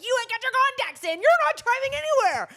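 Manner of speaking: high, loud frantic yell; yelling at Lenny. Retold or imitated. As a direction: snap at him that he can't drive. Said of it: "I'm like".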